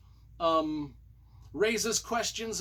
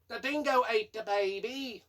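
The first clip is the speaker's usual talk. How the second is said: higher, hammed-up Australian wail; mock Australian; 6 semitones higher than usual. Reviewer giving a joke spoiler, mock Australian. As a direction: do a silly Aussie outcry.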